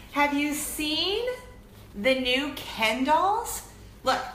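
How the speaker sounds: slow, drawn-out teasing question; excited tease. Real, not acted. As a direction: stretch it out to hook viewers.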